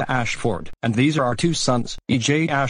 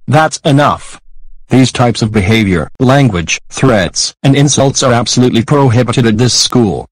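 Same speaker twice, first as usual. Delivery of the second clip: loud stern scolding; angry shouting; 11 dB louder than usual. Acted. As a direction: yell it like a furious teacher. Teacher punishes the bully.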